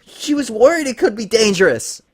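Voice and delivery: high, loud, agonized plea; pained gasping. Acted. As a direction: force it out in pain. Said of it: "Lyra shuddered in agony".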